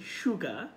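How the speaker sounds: "সুগা" as SHU-ga; sh opening, silent r in "সুগা"; correct pronunciation. Shown as a demonstration. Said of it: "উচ্চারণটা যাতে আপনার ঠিক হয়".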